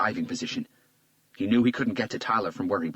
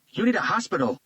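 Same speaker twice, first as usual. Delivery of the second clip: higher and louder, another man; worried partner, voiced higher; Garcia's voice; 12 semitones higher, 5 dB louder than usual. A narrator's reading. Garcia telling bleeding Hunter, higher louder.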